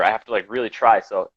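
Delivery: usual speaking voice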